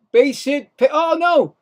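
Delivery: sudden rise into an alarmed "oh, no", high and loud; excited call. Real, not acted. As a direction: call the play as it slips away. Live call of a close play.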